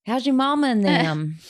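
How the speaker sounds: Southern phrase. Shown as a demonstration. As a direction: call it out with Southern folksiness. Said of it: "That's a Southern phrase".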